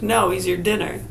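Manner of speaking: normal talking voice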